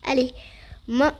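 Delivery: ordinary talk, nothing marked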